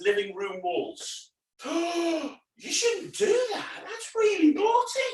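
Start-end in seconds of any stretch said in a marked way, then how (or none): 1.6-2.3 a big high theatrical gasp; mock gasp. Entertainer pretends shock at children's naughtiness, gasping. 2.6-5.0 high, slow pretend telling-off, laughing; mock scolding. Entertainer teases children about colouring walls, mock scolding.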